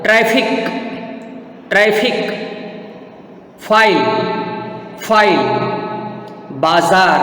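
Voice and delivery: ट्रैफिक and फाइल with f, बाजार with z; word-by-word model of ट्रैफिक, फाइल, बाजार with f and z; nukta pronunciation. Shown as a demonstration. Teacher models for students, ट्रैफिक, फाइल with f, बाजार with z. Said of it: "मैं कैसे करता हूँ नुक्ता वाले शब्दों का उच्चारण".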